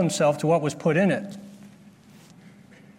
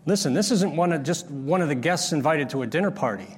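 ordinary talk

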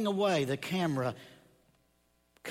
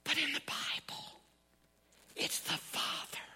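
unvoiced, much softer whisper; hushed whisper to stress the Bible; 11 semitones higher, 7 dB quieter than usual. Real, not acted. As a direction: breathe the words out for emphasis. Preacher's hushed emphasis.

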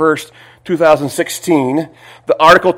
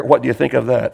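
ordinary delivery

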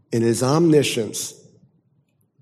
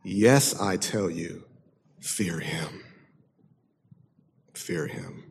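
low, much softer, grave warning; hushed emphatic; 6 semitones lower, 8 dB quieter, slower than usual. Acted. Preacher voicing Jesus's warning to the crowd, hushed and grave. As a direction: drop to a near-hush and press it home. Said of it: "He says emphatically".

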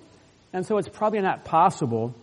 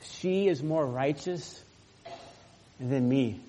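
quiet confession; 7 dB quieter, slower than usual. Acted. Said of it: "what did he say?".

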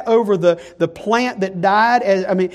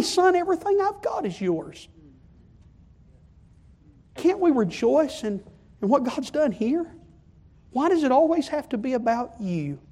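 soft, high, tender pleading; pleading father; 6 semitones higher, 3 dB quieter than usual. Acted. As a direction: gently beg a sulking son to come in. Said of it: "he's gonna plead with him".

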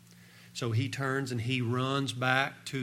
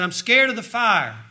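high, loud frightened cry; a little boy panicking at the fire; scared child; 9 semitones higher, 12 dB louder than usual. Retold or imitated. Boy tells his dad he fears the coming fire, loud and scared.

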